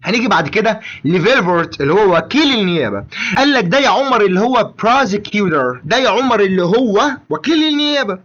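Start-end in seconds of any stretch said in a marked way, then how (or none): none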